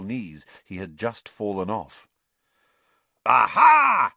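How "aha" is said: loud, high challenge from a little knight; yelled. A narrator's reading. Squat knight seeing Harry, Ron and Hermione, yelling. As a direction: yell it out in triumphant discovery. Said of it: "he yelled".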